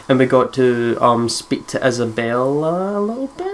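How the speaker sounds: ordinary delivery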